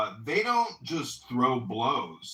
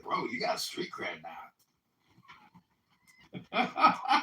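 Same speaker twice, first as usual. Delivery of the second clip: lifeguard quote; 2 semitones lower, slower than usual. Retold or imitated. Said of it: "he's like".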